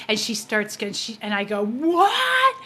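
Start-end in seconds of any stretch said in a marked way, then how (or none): 1.6-2.5 high, loud shocked cry. Retold or imitated. Hearing that her dad is present, a high shocked cry. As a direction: blurt it in disbelief.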